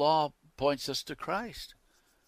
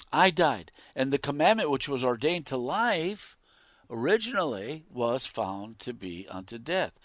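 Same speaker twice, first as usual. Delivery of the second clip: loud, raised pulpit reading; forceful scripture preaching; 4 semitones higher, 9 dB louder than usual. Real, not acted. Preacher reading how sin slew Paul, forceful preaching.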